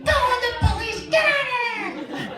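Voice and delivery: high yelling. Retold or imitated. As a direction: yell to scare the burglar off. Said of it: "So I start to yell again".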